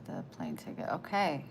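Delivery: usual speaking voice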